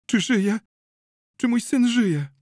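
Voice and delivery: high, loud, frantic question; anxious cry. A narrator's reading. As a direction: cry out as a frightened mother. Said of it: "zawołała pani Czyńska".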